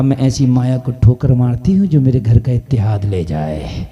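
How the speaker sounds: low, emphatic storytelling with held endings; forceful preaching. Retold or imitated. Preacher voicing old woman rejecting wealth, low forceful declamation. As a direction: drop low and declaim the refusal.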